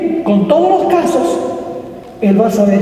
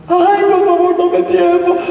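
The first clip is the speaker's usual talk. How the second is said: high-pitched wailing plea; hammed-up tearful begging; mock bawling; 8 semitones higher than usual. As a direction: imitate a debtor bawling for more time. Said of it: "a berrear, a llorar".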